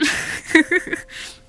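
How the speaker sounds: airy giggle, no voice; cracking up with a friend; breathy laugh. Acted. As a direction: laugh silently through the nose. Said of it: "You both, mm, crack up".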